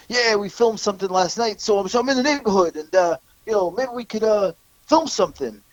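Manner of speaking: high fake-Italian wiseguy voice; mock a fake Italian guy angling to hang out; Fat Shitso impression. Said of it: "fake Italian guy".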